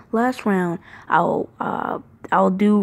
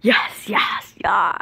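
normal talking voice

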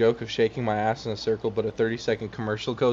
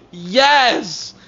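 cheering; 9 semitones higher, 5 dB louder than usual. Real, not acted.

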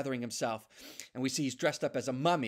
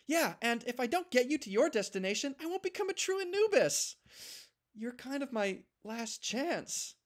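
higher, earnest, slowing at the end; pleading little dog; 10 semitones higher than usual. Acted. Noob pleading with Chance to ride, high earnest voice. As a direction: beg him to stay.

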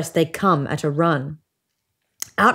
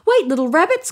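a lightly voiced fox calling, higher; 10 semitones higher, faster than usual. A narrator's reading. Fox calling to the passing rabbits, lightly voiced. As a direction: call after the rabbits as the fox. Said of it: "calls the fox as they pass".

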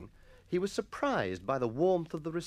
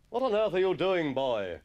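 put-on loud, high opening, dropping on "boy"; demanding schoolmaster; 6 semitones higher, 5 dB louder than usual. A narrator's reading. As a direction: challenge a pupil in indignant alarm. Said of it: "the master demanded".

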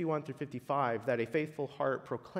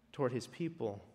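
ordinary talk, nothing marked

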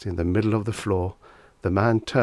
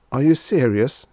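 gasped; 6 semitones higher, 6 dB louder than usual. A narrator's reading. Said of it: "he finally managed to gasp".

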